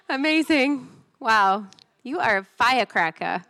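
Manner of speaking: usual speaking voice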